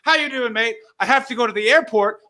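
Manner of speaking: high, falsely chirpy greeting; pretend happy. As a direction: act as if all is fine, voice lifted. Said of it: "have to just pretend to be happy".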